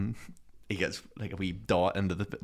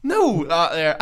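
raised, higher comeback; mocking reply; 7 semitones higher, 6 dB louder than usual. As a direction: throw it back cheekily. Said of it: "I goes".

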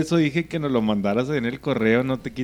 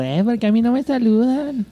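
girlfriend imitation; 10 semitones higher than usual. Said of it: "que luego anda diciendo".

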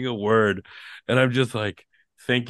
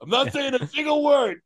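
shouting; 12 semitones higher than usual. Acted. Mock quitting shout.

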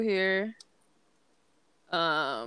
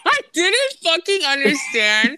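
laughing loudly through the words; can barely get it out for laughing; cracking up at a sibling's memory, laughing hard; 11 semitones higher, 8 dB louder than usual. Real, not acted. Laughing at her sibling's story.

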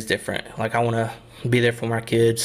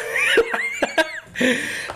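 high laugh burst. Real, not acted. Laughs at own sad story.

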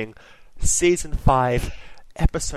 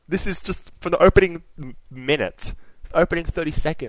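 ordinary delivery

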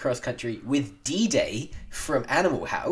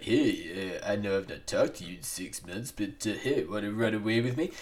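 Sylvester Stallone impression; 2 semitones lower, 4 dB quieter than usual. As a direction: do a Stallone, under your breath. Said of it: "Are you doing Sylvester Stallone impressions".

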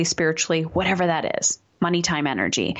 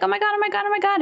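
much higher, breathless oh my God; panicky little girl; 13 semitones higher, much faster than usual. Acted. Little girl Overwhelm panicking on arrival, high frightened squeak. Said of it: "she's really scared right now".

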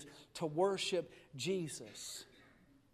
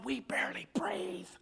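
barely-breathing whisper; hushed; 6 semitones higher, 4 dB quieter than usual. Acted. Preacher mimicking a lifeless congregation, hushed whisper. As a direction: whisper it with hardly any air.

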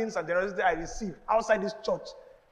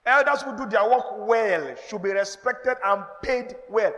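slow emphatic reading; 5 dB louder, slower than usual. Acted. Reads verse slowly, emphatically.

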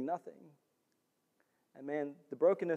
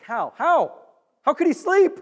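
rising, louder, disbelieving how; can't believe he slept through it; incredulous; 12 semitones higher, 7 dB louder than usual. Acted. Preacher to congregation, incredulous and rising. Preacher baffled by sleeping Jonah.

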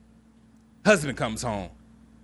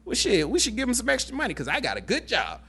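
high, surprised husband voice, much higher; be pleasantly shocked at the lawn; impressed husband; 10 semitones higher than usual. Retold or imitated.

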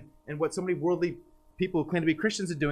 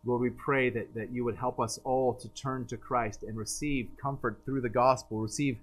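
lower, softer praying voice; pastoral prayer; 7 semitones lower, 5 dB quieter than usual. Acted. Preacher praying for the congregation, low and hushed. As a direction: pray over the church, lower and hushed.

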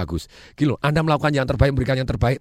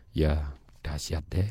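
low, soft and slow, no energy at all; flat listless reply; 9 semitones lower, 7 dB quieter, much slower than usual. Shown as a demonstration. Speaker showing the wrong way to answer "how are you", flat listless mumble. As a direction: drag it out half-heartedly, much lower. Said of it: "Ya ndak dahsyat itu... Nadanya beda".

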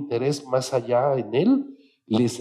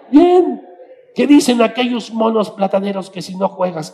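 loud banter; 9 semitones higher, 9 dB louder than usual. Retold or imitated.

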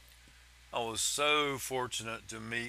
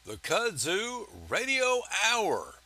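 big, bright radio host welcome; loud, high show intro; announcer voice; 8 semitones higher, 6 dB louder than usual. Acted. Podcast host opening announcement.

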